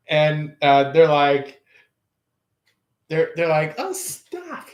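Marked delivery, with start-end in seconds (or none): 3.8-4.7 much higher, softer bashful giggle-voice; coy reply. Pretend. Person answering the compliment, coy and bashful. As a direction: play it shy and flattered.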